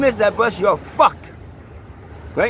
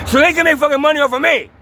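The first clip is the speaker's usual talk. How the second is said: real anger, pitched higher and pushing harder; really pissed off; 7 semitones higher than usual. Real, not acted. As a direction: let the frustration boil over. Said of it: "Yeah, I'm fucking pissed".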